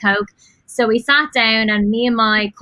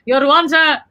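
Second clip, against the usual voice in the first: put-on Cork accent; 4 semitones higher, 4 dB louder than usual. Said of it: "I need to do a Cork accent".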